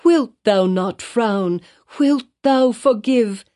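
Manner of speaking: high, slow, pleading question; repeated plea. A narrator's reading. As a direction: beg again and again for pardon. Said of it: "she repeated over and over".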